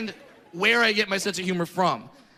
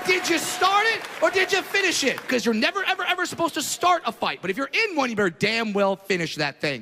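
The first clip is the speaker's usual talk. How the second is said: fired-up rant; 4 semitones higher than usual. Real, not acted. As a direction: hammer the rule home. Preaching dad's rule at full force.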